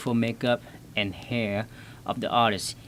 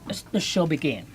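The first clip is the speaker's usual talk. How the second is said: chipmunk voice; 6 semitones higher than usual. Pretend. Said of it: "listen to my chipmunk voice".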